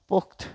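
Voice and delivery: "পোক্ত" as pokt, halted on t; one syllable "পোক্ত", no final o; stopped final consonant. Shown as a demonstration. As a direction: stop dead on the t of "পোক্ত". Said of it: "থেমে যাচ্ছি".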